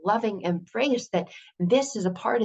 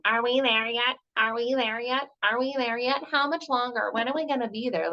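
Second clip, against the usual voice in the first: lightly voiced whiny kid, somewhat higher; annoying child; 4 semitones higher than usual. A hint of an impatient child nagging parents on a road trip. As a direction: nag like a bored kid in the back seat. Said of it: "Like a little annoying child in the back".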